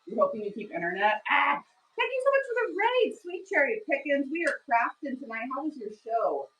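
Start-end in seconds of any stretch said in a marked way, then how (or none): 1.3-1.6 high, loud squeal of delight; a happy yelp; excited squeal. Real, not acted. Streamer greeting an incoming raid, excited squeal. 2.0-4.3 much higher gushing welcome; light up for the raider; excited thanks. Real, not acted. Streamer thanking a fellow streamer for the raid, excited.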